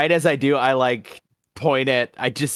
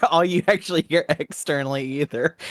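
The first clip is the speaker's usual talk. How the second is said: normal talking voice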